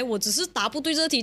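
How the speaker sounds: ordinary talk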